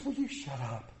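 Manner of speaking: soft, low, sweetly understated telling-off; imitating a wife's kindly put-down; nice, gentle rebuke. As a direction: say it so nicely that it barely registers. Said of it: "But she says it's so nice".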